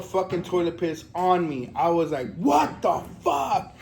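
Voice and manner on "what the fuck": high, long drawn-out outraged cry; mock yell. Retelling getting sprayed by the toilet, drawn-out mock yell. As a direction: stretch it out in a high mock holler. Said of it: "And I yelled loud".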